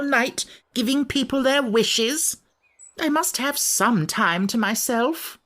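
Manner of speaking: high, grumpy creature voice; a cross little sand-fairy; Samiad voice. Acted. Samiad character voice.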